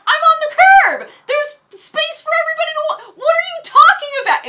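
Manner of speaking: high, loud retold outburst; high-pitched argumentative yell; incredulous shouting. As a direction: yell back in exasperated disbelief. Acted quarrel with the valet.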